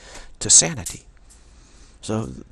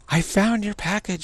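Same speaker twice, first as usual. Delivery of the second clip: mimicking her; 10 semitones higher, 4 dB louder than usual. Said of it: "And she goes".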